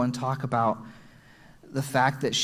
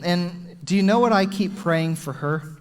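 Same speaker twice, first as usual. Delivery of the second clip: usual speaking voice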